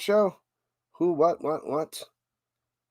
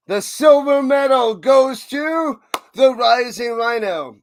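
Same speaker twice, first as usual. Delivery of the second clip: higher, louder, slow grand presenter; mock award announcer; 6 semitones higher, 6 dB louder, slower than usual. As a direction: announce the prize like a ceremony host.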